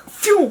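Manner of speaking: high, loud "phew" of something shooting off; imitated horse whoosh. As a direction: mimic the horse bolting with a whoosh. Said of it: "the horse is just like".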